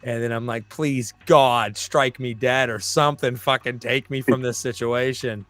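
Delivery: usual speaking voice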